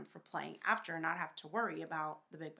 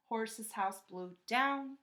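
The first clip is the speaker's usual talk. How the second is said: ordinary delivery, nothing marked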